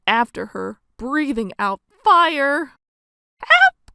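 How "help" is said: very high, loud scream for help; cry for help. Acted. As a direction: yell for help with all your might. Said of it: "cried the witch".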